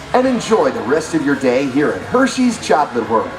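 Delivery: usual speaking voice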